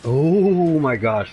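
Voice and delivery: slow loud startled gasp of words; real fright, drawn out. Real, not acted. Near miss in the game.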